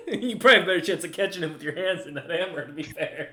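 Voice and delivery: quick, amused talk through laughter; laughing. Real, not acted. Game master teases player about throwing a man, laughing. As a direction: tease while still chuckling.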